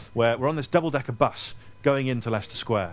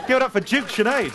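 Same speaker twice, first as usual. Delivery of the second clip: louder, higher hype call; announcing to crowd; 10 semitones higher, 5 dB louder than usual. Real, not acted. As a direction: get the room cheering. Rallying the audience.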